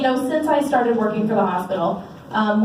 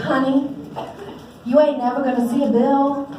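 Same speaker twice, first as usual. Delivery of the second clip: put-on higher-pitched Southern accent, retelling her words; thick Southern accent; 3 semitones higher than usual. Big woman grabbing a bawling mother by the shoulders, imitated thick Southern accent. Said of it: "with this thick Southern accent".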